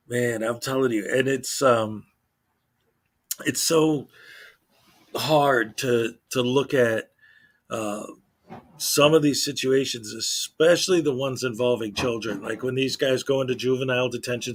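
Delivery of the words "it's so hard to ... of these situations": usual speaking voice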